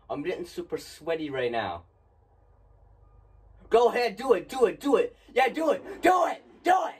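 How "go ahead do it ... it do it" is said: nervous taunt building to a high yell; defiant shouting dare. Real, not acted. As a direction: scream "do it" at the game, getting higher. Taunting the game to jump scare.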